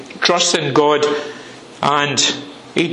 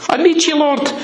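high, pleading cry to the Lord; pleading prayer; 5 semitones higher than usual. Acted. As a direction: beg God to listen, voice lifted high. Preacher acts out pleading prayer.

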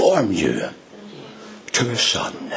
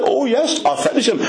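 much higher, breezy mock promise; Billy airily promising Ethel he'll finish; 14 semitones higher, faster than usual. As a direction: brush her off cheerfully. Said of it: "Billy started them".

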